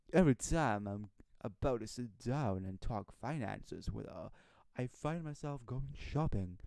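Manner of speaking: husband admitting he keeps shopping, in a man voice. Acted. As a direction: read the husband's line as a guy would say it. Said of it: "Your man voice is good".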